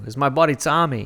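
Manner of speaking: mock Boston guy introducing his buddy; repeating the friend's intro in a hammed-up Boston accent. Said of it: "That was very Boston of you".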